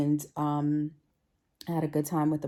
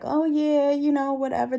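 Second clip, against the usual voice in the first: high, loud, breezy brush-off; dismissive; 9 semitones higher, 5 dB louder than usual. Retold or imitated. Other ladies brushing off the coworker's question, high and careless. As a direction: wave it away, unconcerned. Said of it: "some of the other ladies was like".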